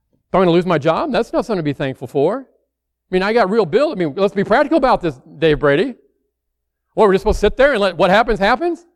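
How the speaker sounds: indignant protest. Retold or imitated. Doubter pushes back at the preacher Dave Brady, exasperated. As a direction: argue it's just not practical. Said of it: "You might say".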